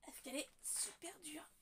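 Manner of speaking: hushed whisper to herself. Real, not acted. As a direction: whisper under her breath. Struggling alone with stuck bottle.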